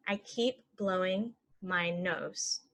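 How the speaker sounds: slow and even; slow model. Shown as a demonstration. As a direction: say it slowly for learners. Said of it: "normal then slow".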